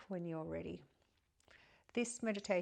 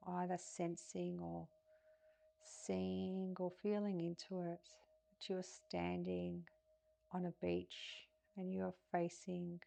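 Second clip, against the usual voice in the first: softer, calm guiding voice, phrase by phrase; guided meditation; 2 semitones higher, 6 dB quieter than usual. Acted. Guiding listeners through breath and a pier visualization, meditative.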